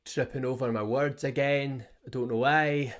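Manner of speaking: lilting put-on Scottish accent. Podcaster laughing off a fumble over words, put-on Scottish accent. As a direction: slip into a mock Scottish accent. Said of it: "when I do a Scottish accent".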